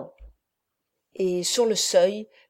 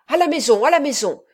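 louder, higher urgent command; urgent order; 5 semitones higher, 6 dB louder, faster than usual. A narrator's reading. Renzo urging the women home, urgent loud order. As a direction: hurry them home. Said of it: "dit Renzo".

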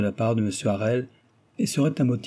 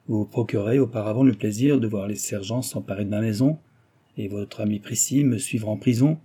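ordinary delivery

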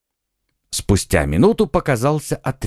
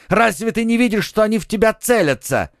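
urgent shout; 10 semitones higher than usual. A narrator's reading. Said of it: "крикнул Д'Артаньян".

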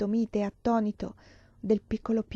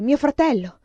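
loud, raised cry of outrage; furious, pitched-up shout; roaring; 6 semitones higher, 6 dB louder than usual. A narrator's reading. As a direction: bellow it in grief-stricken anger. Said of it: "ruggì Vasili".

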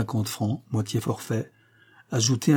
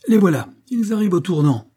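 higher and louder, calling the race; excited call; 8 semitones higher, 5 dB louder than usual. A narrator's reading. Holmes calls out as the horses turn. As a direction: call out suddenly. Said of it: "cria-t-il tout à coup".

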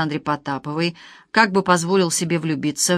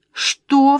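higher, louder shocked cry; jolted by the news, cry it out; 7 semitones higher, 5 dB louder than usual. A narrator's reading. Vika hearing about the ex-wife, shocked cry. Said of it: "вскричала Вика".

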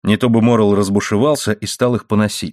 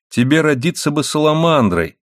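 plain reading voice

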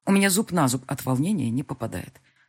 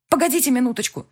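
urgent plea; 8 semitones higher, 5 dB louder than usual. A narrator's reading. Heroine begs dispatcher to wait.

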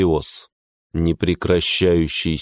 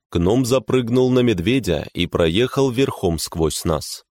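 usual speaking voice

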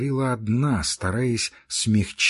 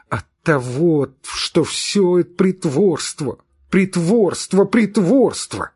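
higher, louder, building angry outburst; childish anger; 6 semitones higher, 6 dB louder than usual. Acted. Girl to her friend, childish angry outburst. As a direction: burst out at yourself, hot and hurt. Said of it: "детский гнев своего друга".

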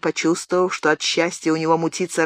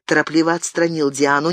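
ordinary delivery

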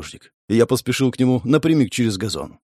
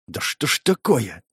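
frustrated growl; 3 dB quieter, slower than usual. A narrator's reading. Man hanging up on a useless call, growling. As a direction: growl it slowly under your breath. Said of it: "зарычал я".

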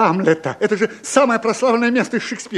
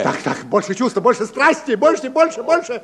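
rising, pushing for more passion; urging coach; 5 semitones higher than usual. Real, not acted.

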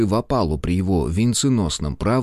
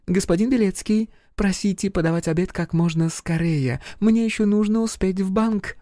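high-pitched French lady voice; femme fatale; 10 semitones higher than usual. Acted. Charlotte's voice again.

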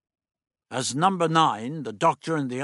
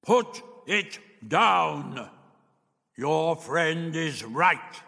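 higher, very slow booming command; 5 semitones higher, 3 dB louder, much slower than usual. A narrator's reading. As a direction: boom it out, stretching every word. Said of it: "a voice boomed out".

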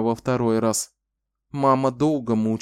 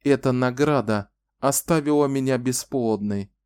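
usual speaking voice

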